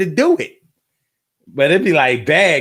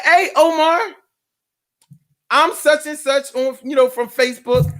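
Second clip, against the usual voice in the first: imitating her greeting; 8 semitones higher than usual. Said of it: "She was like".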